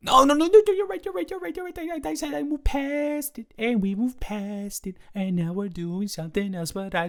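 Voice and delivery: high, hammed-up defensive protest; mock defensive.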